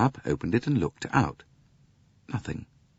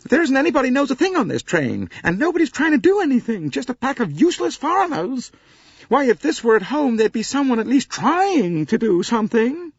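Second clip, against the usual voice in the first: high, loud American wail; wailing Mrs Hubbard; 6 dB louder than usual. Acted. Mrs Hubbard wails to the passengers about being stranded. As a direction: lament loudly like a fussy American matron.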